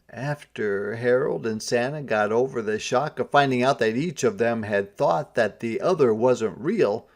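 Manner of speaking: ordinary delivery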